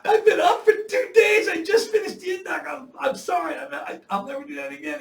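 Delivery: very high, shouted comic plea; hammed-up frantic apology, loud and high; panicked pleading. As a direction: plead at full volume in a squeaky panic. Acted panicked plea to sergeant major.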